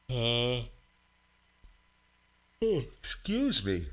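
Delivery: talking through a yawn. Real, not acted. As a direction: say it mid-yawn. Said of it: "Yawning into the camera".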